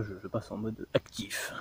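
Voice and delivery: hushed conspiratorial whisper. Real, not acted. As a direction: whisper it like a secret. Whispered aside about active mode.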